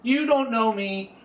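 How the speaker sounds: high, loud fake fury; mock temper tantrum. Host blows up at co-host over the intro, mock furious rant. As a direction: rant like a diva who lost his take. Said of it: "sorry about his little temper tantrum".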